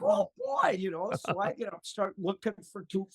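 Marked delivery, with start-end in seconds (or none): 0.0-0.7 high, eager exclamation; eager oh boy. Retold or imitated. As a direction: jump at the idea. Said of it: "I'm like".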